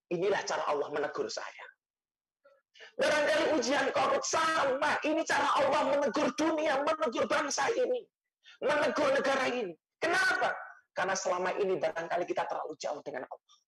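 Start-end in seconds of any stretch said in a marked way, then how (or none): 3.0-10.5 high-pitched, insistent sermon delivery; emphatic preaching. Real, not acted. Preacher warning the nation of God's rebuke, high and emphatic. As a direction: drive each repeated point home in a high voice.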